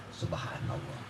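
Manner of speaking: breathless whispered subhanallah; whispered in wonder; awed whisper. Real, not acted. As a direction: drop to a hushed, voiceless whisper of awe. Awed exclamation after story climax.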